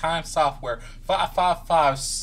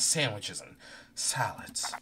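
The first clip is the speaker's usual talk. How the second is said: trailing whisper; 5 semitones lower, 13 dB quieter than usual. Acted.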